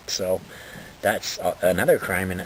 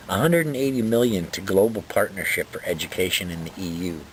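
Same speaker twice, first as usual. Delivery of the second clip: ordinary talk, nothing marked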